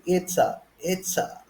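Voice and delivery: "It's a" with a crisp T; "It's a" as the first two syllables of Italy, T fully sounded; British T. Shown as a demonstration. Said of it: "if you're talking about a British accent".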